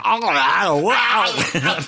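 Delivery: loud, high gasping wow; mock a head about to explode; hammed-up pop-eyed wow; Schwarzenegger in a vacuum. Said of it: "like Schwarzenegger in a vacuum".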